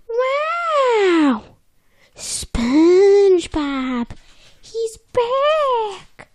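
high excited shout; over-the-top excited. Retold or imitated. Fish at the window spotting SpongeBob, loud excited shout. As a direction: shriek it out as a thrilled fish. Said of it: "Okay, he doesn't say it like that".

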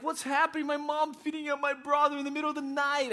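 high, loud mock wail; crying child, imitated. Boy woken by mom's attack, mock crying. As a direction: wail like a bewildered kid, hammed up. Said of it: "I'm crying".

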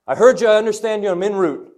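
loud, high eager answer to God; responding to the Lord with eager resolve; fired up. Pretend. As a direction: shout it like a soldier ready to go. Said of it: "Copy that. Here we go".

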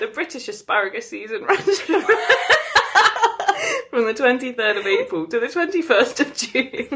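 trying to read through laughter; real giggling. Real, not acted. Reading a silly article to co-host, cracking up. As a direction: giggle helplessly while reading aloud.

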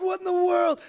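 high, loud mock disbelief; yelled. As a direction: imitate a flabbergasted man shouting. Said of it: "he's, he yelled".

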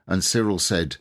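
ordinary delivery, nothing marked

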